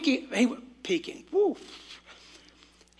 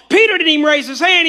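usual speaking voice

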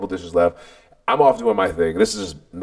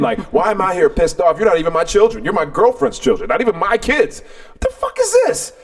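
exasperated rant climbing higher and higher; pissed off; 7 semitones higher than usual. Retold or imitated. Fed-up man venting at his girlfriend's children, increasingly pissed off. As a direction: build from irritation to a high-pitched outburst. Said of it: "I'm pissed off".

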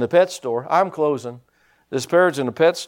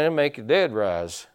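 ordinary delivery

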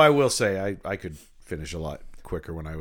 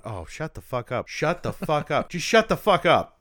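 starts muttered, builds higher and louder; mounting mock exasperation; 7 semitones higher, 4 dB louder than usual. Annoyed viewer yelling at character, building. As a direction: grow more fed up each time. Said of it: "I'm going".

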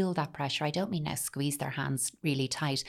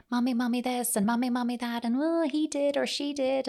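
put-on high, nagging child's voice, pitched well up; whining child; 8 semitones higher than usual. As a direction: whine for mommy's attention the moment she walks in. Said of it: "this parent felt".

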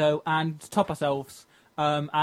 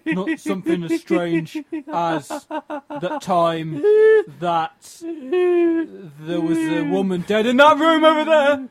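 slow cockney geezer; 8 semitones higher, 4 dB louder, much slower than usual. Acted. Improvised character, slow.

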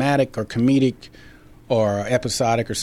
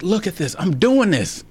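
giddy excitement; 7 semitones higher than usual. Retold or imitated. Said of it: "I was like".

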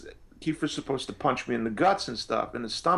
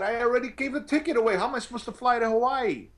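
incredulous retold protest; 9 semitones higher than usual. Retelling his panicked reply to manager.

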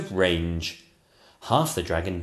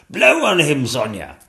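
higher, louder urgent command; king crying out; 6 semitones higher, 9 dB louder than usual. Acted. King urging Sonia against the dragon, high and loud. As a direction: urge her on. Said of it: "cried the King".

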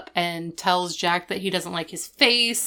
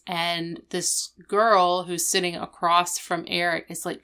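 normal talking voice

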